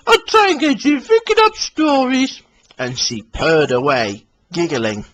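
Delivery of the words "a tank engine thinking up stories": Daisy voice. Acted. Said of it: "laughed Daisy".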